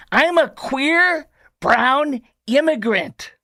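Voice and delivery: high, slow mock proclamation; hammed-up declaration, word by word; mocking quote. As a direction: read it out with exaggerated grandeur. Mocks a quote he finds absurd.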